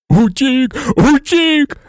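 high, loud joyful wail; sobbing. A narrator's reading. Hagrid learning Buckbeak escaped, sobbing loudly. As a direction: wail it out, overwhelmed. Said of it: "ридав він".

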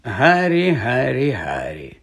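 name repeated, stepping down in pitch and volume; patronising, sinking triple call; impatient. A narrator's reading. Professor correcting a naive pupil, impatient. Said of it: "нетерпляче похитав головою Локарт".

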